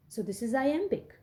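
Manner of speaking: ordinary talk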